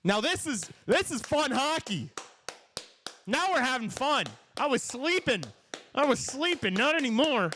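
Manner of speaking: high-pitched, elated hockey fan; giddy, high commentator voice; excited commentary. Real, not acted. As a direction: revel in the game coming alive. Thrilled the game opened up.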